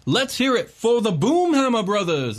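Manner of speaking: high, loud goblin announcer; booming prize announcer. Acted. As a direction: hype up the crowd like a goblin MC. Announcer introduces the teams.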